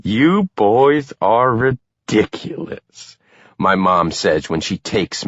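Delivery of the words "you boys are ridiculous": exasperated. A narrator's reading. Mom scolds the men.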